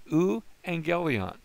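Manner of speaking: teacher modelling the Greek word slowly; careful pronunciation. Shown as a demonstration. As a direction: sound out "Euangelion" for students. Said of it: "we're going to pronounce it euangelion".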